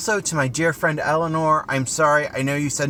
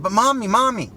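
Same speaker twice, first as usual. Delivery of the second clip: put-on higher, louder whine of a small boy pleading; whiny child; 10 semitones higher, 5 dB louder than usual. A small boy pleading with his mom for both toys, whining. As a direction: beg like a five-year-old who won't take no.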